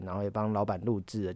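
ordinary talk

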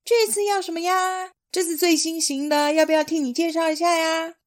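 high, wheedling dealer; fawning wolf voice. Acted. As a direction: pitch it high and ingratiating. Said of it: "高声喊着".

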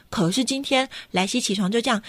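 ordinary delivery

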